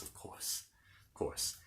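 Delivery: a quick hushed aside to the viewers; whispered aside. Real, not acted. Reteller whispering a reassurance about Aslan to viewers. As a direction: whisper it under your breath.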